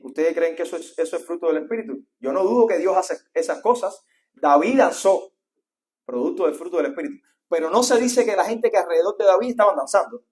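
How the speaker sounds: loud preacher driving the point home; full-force preaching. Acted. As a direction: build the sermon to a loud peak.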